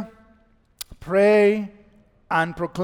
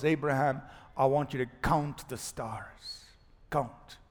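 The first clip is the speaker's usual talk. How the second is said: gentle God; 5 semitones lower, 10 dB quieter than usual. Retold or imitated. God speaking to Abraham.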